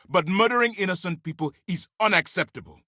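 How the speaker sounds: indignant commander drawing the line; angry condemnation. Acted. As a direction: condemn it with force. Commander condemns killing of innocents.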